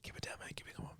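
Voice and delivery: quiet stern whisper; librarian shushing whisper. Pretend. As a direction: hush them like a librarian. Pretend library bit.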